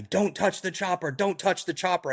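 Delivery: high-pitched mock yell at the rescued man; retold yell. Crew chief yelling at the hypothermic hiker, mock high yell. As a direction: bark the order up high, retelling it. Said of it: "he's just yelling at me".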